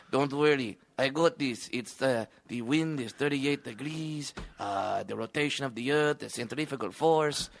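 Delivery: quiet mock Mexican sniper accent. Mexican sniper calmly reading the wind, in a quiet mock accent. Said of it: "hearing you say centrifugal force in that accent".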